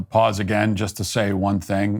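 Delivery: normal talking voice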